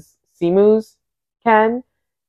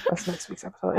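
hushed aside; 14 dB quieter, faster than usual. Real, not acted. Hushed repeat.

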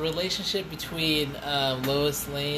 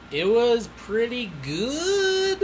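slow, high, drawn-out "good"; noncommittal, lingering delivery; hedged verdict; 8 semitones higher, much slower than usual. Real, not acted. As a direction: stretch it out high, as if weighing it up. Teasing hesitant verdict.

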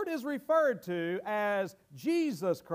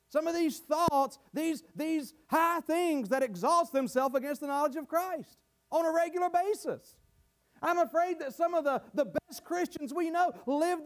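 raised, earnest preaching voice; 5 semitones higher than usual. Acted. Pastor warning the faithful about lying words, earnest raised preaching. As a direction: press the warning on the flock.

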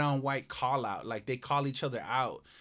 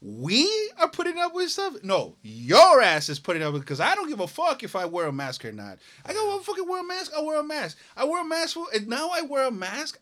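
higher, worked-up mock retort; indignant retort; 10 semitones higher, 5 dB louder than usual. Retelling his comeback to the maskless man, indignant. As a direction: fire it back in disbelief.

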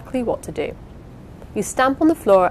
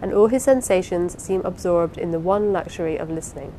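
usual speaking voice

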